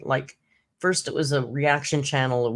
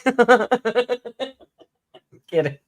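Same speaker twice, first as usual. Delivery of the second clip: laughing; 7 semitones higher, 4 dB louder than usual. Real, not acted.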